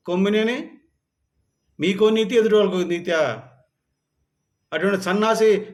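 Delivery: furious scolding of a journalist; angry rant. Real, not acted. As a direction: berate him in rising fury. Ranting at the TV journalist.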